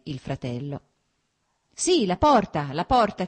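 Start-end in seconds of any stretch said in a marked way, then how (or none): 1.7-3.3 shouting. A narrator's reading. Levin shouts at his brother to shut the door against flies. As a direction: yell to get the door shut. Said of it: "gridò".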